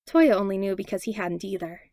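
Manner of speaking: plain reading voice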